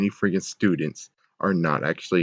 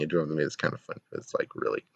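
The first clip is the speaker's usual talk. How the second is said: quiet aside; 5 semitones lower, 10 dB quieter, much faster than usual. Real, not acted. Amused aside, trailing off.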